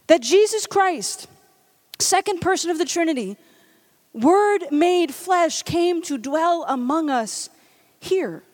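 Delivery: slow, reverent proclamation; solemn preaching. Acted. Preacher reaching the point about the incarnation, slow and reverent. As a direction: let each phrase land slowly.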